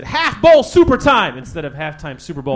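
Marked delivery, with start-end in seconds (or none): 0.0-1.4 big, high, drawn-out hype shout. Retold or imitated. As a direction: belt it out slowly like a halftime announcement. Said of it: "he fucked up and he said".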